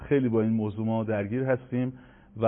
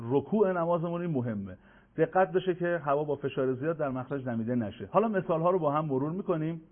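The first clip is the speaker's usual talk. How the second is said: usual speaking voice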